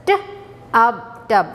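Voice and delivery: T, up, tub split into sounds, then joined; phonic sounds. Shown as a demonstration. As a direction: sound out for young learners. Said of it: "How will you read with phonic sound?".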